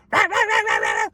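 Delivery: playful imitation of a yapping dog; mock dog barking. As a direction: bark it like a little demon dog through a fence. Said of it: "little demon dog going".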